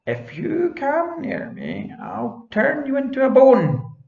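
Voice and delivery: high, slow, rising to a loud threat; glaring witch threat. Acted. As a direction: threaten the dog with menace. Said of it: "She glared at him and cried".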